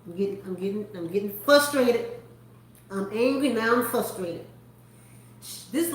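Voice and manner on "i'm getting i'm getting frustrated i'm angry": ordinary delivery, nothing marked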